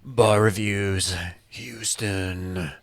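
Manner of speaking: slow, deep radio promo announcer. Acted. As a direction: draw it out like a club radio promo. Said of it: "you brought out the, the stripper P A guy again".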